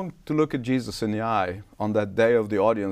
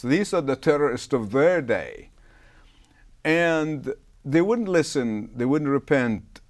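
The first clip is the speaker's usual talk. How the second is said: ordinary delivery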